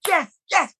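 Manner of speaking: high, jubilant yeses; over the moon. Retold or imitated. Actor celebrating his friend's casting, over the moon. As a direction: cheer it high, imitating a delighted friend. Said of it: "he was just over the moon".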